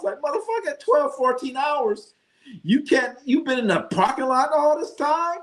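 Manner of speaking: high, incredulous squeal of disbelief; can't believe they've been there that long; shocked disbelief. Retold or imitated. Stunned by the hog cooked fourteen hours, high incredulous squeal.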